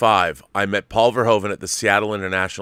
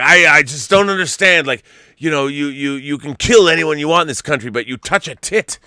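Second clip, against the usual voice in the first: imitating a Dutch filmmaker, a bit higher and louder; Dutch accent; 4 semitones higher, 4 dB louder than usual. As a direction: loose Dutch accent, mock rant. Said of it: "in his Dutch brogue or whatever".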